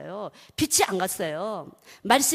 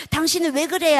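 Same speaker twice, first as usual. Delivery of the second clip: loud, raised-pitch mock reproach; nagging spouse; 6 semitones higher, 6 dB louder than usual. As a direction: scold a spouse in mock exasperation.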